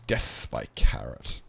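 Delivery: low unvoiced whisper of doom; spooky whisper, drawn out; whispered ominously. Shown as a demonstration. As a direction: hush it like a dark punchline. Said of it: "repeat for effect".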